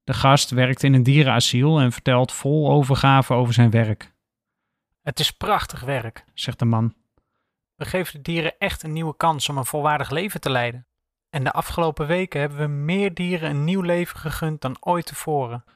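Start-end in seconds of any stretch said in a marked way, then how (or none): 5.0-15.6 softer, like a voice from the television; TV guest, distant and soft. A narrator's reading. Talk show guest praising animal shelter work, soft TV voice. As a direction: speak as if heard through a TV set. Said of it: "zegt hij enthousiast".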